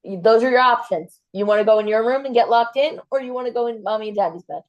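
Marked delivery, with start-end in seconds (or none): none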